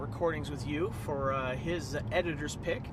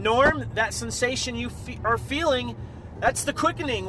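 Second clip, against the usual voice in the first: epic proclamation; 9 semitones higher, 10 dB louder than usual. Acted. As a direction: proclaim it like an immortal warrior revealing a destiny. Mock-epic Highlander sign-off to Norm.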